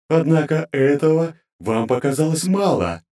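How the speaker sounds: high, grating divine voice; cold goddess interrogating her servants; inhuman goddess voice. Acted. Goddess continues.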